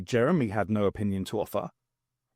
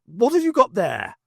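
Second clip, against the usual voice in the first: higher, louder question; demanding; 8 semitones higher, 5 dB louder than usual. Acted. Brigadier demanding to see the object, louder. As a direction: press him to show it.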